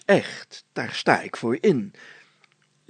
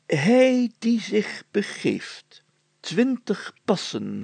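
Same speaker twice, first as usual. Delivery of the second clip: put-on slow, higher, ceremonious recital of an old deed; solemn archaic reading; 5 semitones higher, slower than usual. Mayor reading an old parchment aloud to his friends, slow and solemn. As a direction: read it like a proclamation from centuries past.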